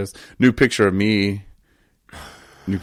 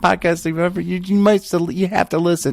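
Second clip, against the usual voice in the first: higher, hammed-up sales pitch; mock hype pitch; 11 semitones higher, 4 dB louder than usual. Urging listeners to fake-love the podcast, mock hype. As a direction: oversell it like a cheesy ad.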